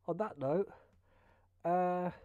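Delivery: ordinary delivery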